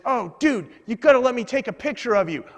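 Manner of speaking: imitating an eager fan asking a favor; excited passerby. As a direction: gush like a thrilled fan. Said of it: "so then he says".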